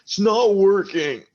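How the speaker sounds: put-on high, slurring drunk arguing with his buddy; drunk quarrel. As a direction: snap at your drunk friend to calm down. Drunk Jacob rubbing sticks.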